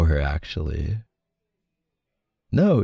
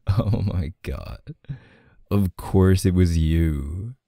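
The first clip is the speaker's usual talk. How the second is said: ordinary talk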